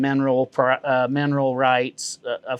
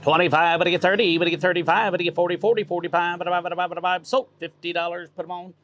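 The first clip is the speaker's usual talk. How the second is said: higher, rattling auctioneer chant on one pitch; 5 semitones higher, faster than usual. Shown as a demonstration. Auctioneer shows off his chant for the interviewer, auctioneer voice. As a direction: run the bids together like an auctioneer. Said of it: "give us your auctioneer voice".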